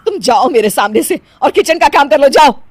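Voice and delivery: loud, high scolding order; angry dismissal. Acted. Mother-in-law ordering her to the kitchen, angrily. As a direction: send her away crossly, building loud.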